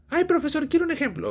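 high-pitched pleading student voice; squeaky student asking the professor; eager student. Acted.

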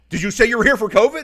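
high, loud and quick retold query; incredulous loud question. Check-in worker double-checks with a patient, loud and incredulous.

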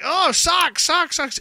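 high, loud mock delight; family teasing mom about socks, mock delight; mocking sock cheer. Kids mocking sock gift.

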